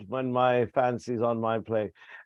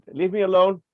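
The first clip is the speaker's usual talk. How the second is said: higher and louder, a sharp royal order; commanding; 7 semitones higher, 8 dB louder, faster than usual. Acted. King orders Charles away to be alone, a loud command. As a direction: send him away, suddenly loud.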